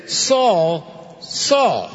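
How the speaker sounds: Saul, Saul called out high and ringing; voice of Christ calling. Acted. A voice from heaven calling Saul by name, ringing out. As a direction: call his name like a voice from heaven. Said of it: "And a voice speaks to him and says".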